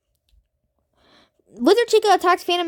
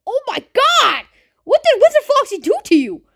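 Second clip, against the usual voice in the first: high, loud shocked exclamation; shocked outcry; 9 semitones higher, 5 dB louder than usual. Real, not acted. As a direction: cry out in disbelief at the screen. Shocked reaction to game event.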